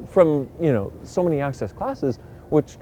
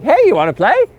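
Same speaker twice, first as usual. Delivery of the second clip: flirty invite; 10 semitones higher, 7 dB louder than usual. Retold or imitated. Flirty friend calls to invite.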